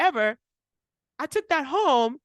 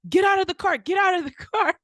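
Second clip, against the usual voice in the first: louder, higher barked order; mock cop yell; 7 semitones higher, 4 dB louder, faster than usual. Cop flinging open her door, mock yell. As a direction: snap it like a cop yanking the door. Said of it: "flings my door open".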